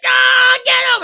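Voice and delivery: high, loud mock battle cry; mock mob yell. Acts out mob chasing him.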